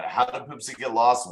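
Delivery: ordinary talk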